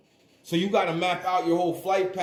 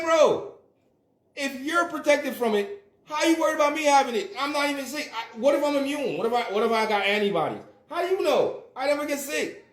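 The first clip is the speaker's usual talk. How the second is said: heated retort; 8 semitones higher than usual. Retold or imitated. Arguing with his parents, high exasperated voice. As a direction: fire back at them in disbelief. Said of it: "And I'm like".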